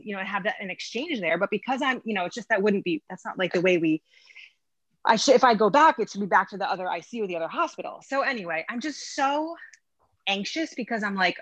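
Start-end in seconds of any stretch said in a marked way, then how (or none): none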